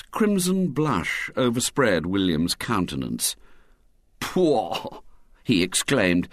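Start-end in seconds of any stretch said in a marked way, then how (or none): 4.2-5.0 higher exclamation of disbelief; appalled scoff. A narrator's reading. William denying the girl's story, appalled. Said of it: "as if appalled at the depth".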